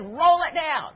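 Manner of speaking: high, loud insistent order; sharp command. Retold or imitated. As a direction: bark it at her through the glass. Stranger insists again.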